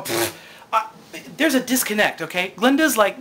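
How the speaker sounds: ordinary delivery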